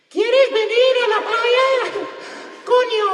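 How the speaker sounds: very high, loud puppet voice; swearing sock puppet. Acted. Mother talking with her hands to grandmother, shrieky sock-puppet voice. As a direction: squawk it out like a ridiculous sock puppet. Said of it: "like a naked sock puppet is swearing".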